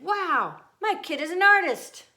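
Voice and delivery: high, loud delighted mother; proud mother gushing over her kid; excited praise. Acted. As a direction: gush over the drawing like a proud mom. Mother praising child's drawing.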